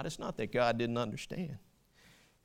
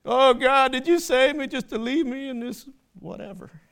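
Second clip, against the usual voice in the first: mock whining, climbing high and loud; whiny complainer; 9 semitones higher, 9 dB louder than usual. Preacher mocking a whining believer, high hammed-up whine. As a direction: ham up a self-pitying believer crying to God. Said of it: "We ever whine and complain and murmur".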